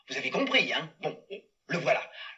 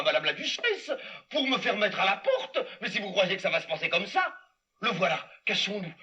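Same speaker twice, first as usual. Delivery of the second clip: ordinary talk, nothing marked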